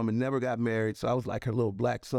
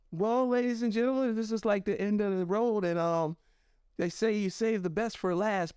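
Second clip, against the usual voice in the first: higher-pitched imitation of a lady emcee; event host; 8 semitones higher than usual. Professor to convention crowd before last speaker, imitated host. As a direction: address the hall as an imitated host.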